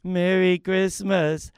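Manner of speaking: ordinary talk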